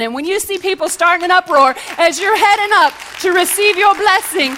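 loud, high preacher's call; full-force preaching. Acted. Pastor warning the congregation about grumblers, in full-force preaching. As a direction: drive it home to the church. Sermon climax, louder and higher.

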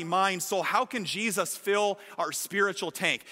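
ordinary delivery

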